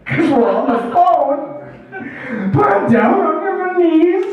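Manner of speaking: big desperation. Acted. Addict son begs mom for a scoop, desperate. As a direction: plead as if down on your knees, rising to a wail. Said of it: "the big desperation".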